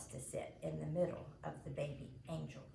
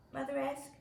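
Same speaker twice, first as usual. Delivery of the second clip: usual speaking voice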